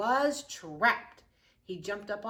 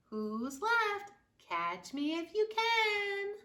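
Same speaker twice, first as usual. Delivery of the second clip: much higher, taunting little voice; cheeky gingerbread baby; 10 semitones higher, 3 dB louder than usual. Acted. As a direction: tease them like a cocky cookie. Gingerbread baby's boasting chant.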